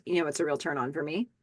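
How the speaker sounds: low, soft come-on; sultry Lisa. Acted. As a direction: purr it back at him. Lisa flirting back at Warren.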